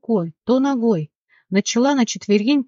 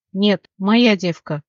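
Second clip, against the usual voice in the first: usual speaking voice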